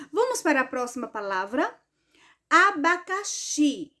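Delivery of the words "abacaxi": slow, higher "Abacaxi", stressing "xi"; teacher's drawn-out "Abacaxi", final "xi" strongest; stress on last syllable. Shown as a demonstration. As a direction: sound out "Abacaxi" and land firmly on "xi". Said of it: "A sílaba mais forte foi o xi".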